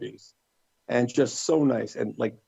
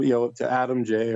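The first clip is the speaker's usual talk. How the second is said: ordinary talk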